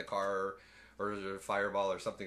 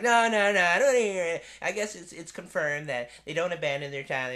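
much higher, louder flustered voice; mock Julianne Moore; 7 semitones higher, 7 dB louder than usual. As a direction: babble it like a flustered scientist. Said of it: "Julianne was like".

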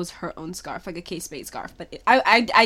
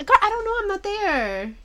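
high, loud mock protest; acted-out clueless comeback; exasperated reply; 12 semitones higher, 4 dB louder than usual. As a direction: throw up a loud, high-pitched denial. Acting out her reply to mom.

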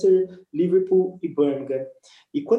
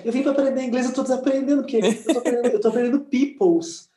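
higher-pitched, amused exasperation; mock indignant complaint; 8 semitones higher than usual. Retelling complaint to Priscila about learning English, mock indignant. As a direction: complain in a raised pitch, half laughing.

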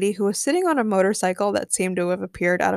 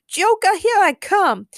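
high, loud, drawn-out cry; gleeful call; 11 semitones higher, 6 dB louder, slower than usual. A narrator's reading. Harley calls to Joker as she leaps, gleefully.

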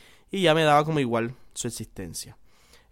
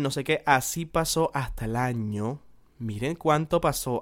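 ordinary talk

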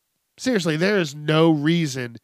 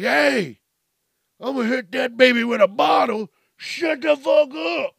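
high, loud strained yelling; acted angry shout; 8 semitones higher, 5 dB louder than usual. Bar patron yells at a crying baby, mock furious shout. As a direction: yell a hammed-up threat.

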